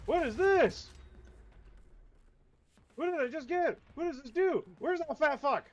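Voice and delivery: high, loud confused outbursts; excited high shout. Real, not acted. Gamer grabbing a pickup, excitedly shouting. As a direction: blurt it out, rattled and loud. Confused, hunting enemy in game.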